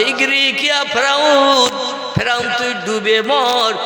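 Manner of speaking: high ringing curse; prophet's curse. Acted. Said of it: "মুসা নবী আবার বলছে". (উঁচু গলায় অভিশাপ)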